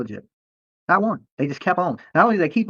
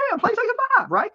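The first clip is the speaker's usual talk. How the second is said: very high, hammed-up gasp of joy; mock delight. Dolly mocks his own thrilled reaction to a gift, high mock glee. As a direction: fake thrill at the prize.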